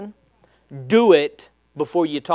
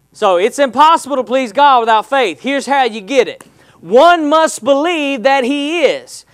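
full-force preaching; 5 semitones higher, 5 dB louder than usual. Acted. Pastor driving home faith to congregation, preaching at full force.

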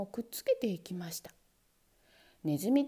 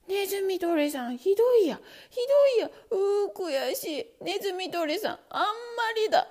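high, loud wailing sobs; crying in panic; 8 semitones higher, 6 dB louder than usual. Acted. Trapped mouse crying to the mousetrap in panic. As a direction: wail trapped and frantic. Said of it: "泣くやら".

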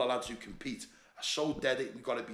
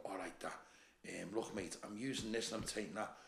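low, hushed voice of someone confessing; confiding; 3 semitones lower, 11 dB quieter than usual. Retold or imitated. Lad privately asking him about what he's taking, confiding quietly. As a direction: keep it down, much softer and lower.

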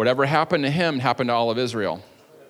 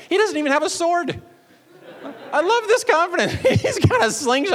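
much higher, breaking into laughter; real amused laugh, pitched way up; laughing with delight; 3 dB louder than usual. Real, not acted. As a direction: giggle at the sheer nerve of it. Preacher delighting in David's boldness.

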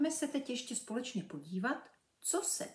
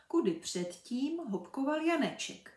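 plain reading voice

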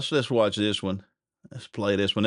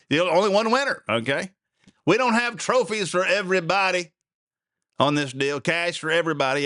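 emphatic mock scolding; 8 semitones higher than usual. Real, not acted. Mocking complainers, no trophies for all.

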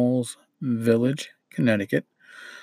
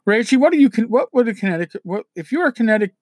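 raised, high-pitched call across the house; 10 semitones higher, 5 dB louder, faster than usual. Real, not acted. Host asks Rachie what Connecticut people are called, calling out. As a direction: call over to someone away from the mic. Calls to Rachie off mic with question.

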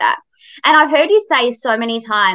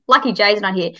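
usual speaking voice

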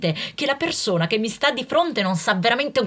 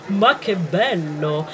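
sugary small talk; 3 semitones lower, slower than usual. Acted. Acting a flirty smalltalk.

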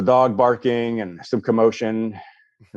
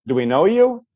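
higher, wary "Do we know you?"; suspicious homeowner; 6 semitones higher, 4 dB louder than usual. Retold or imitated. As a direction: doubt the stranger at the door. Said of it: "she's like".

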